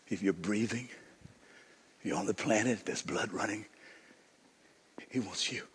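low, soft voice with long pauses; quiet, solemn preaching. Real, not acted. Preacher inviting everyone to the forty days, low and hushed. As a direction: speak gently and low, leaving space.

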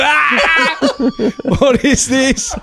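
high, slow exclamation broken by laughter; laughing disbelief. Retold or imitated. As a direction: cry out in shock through laughs. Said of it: "I looked at him and just".

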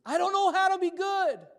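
very high, loud mock wail; hammed-up, full-volume childish outcry; dramatic child's wail. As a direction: imitate your son wailing in despair. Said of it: "can make anything more dramatic".

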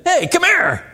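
high, loud commanding hey come here; loud demanding call; sharp loud call. Shown as a demonstration. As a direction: bark a summons across the room. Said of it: "you hear the difference in those two things".

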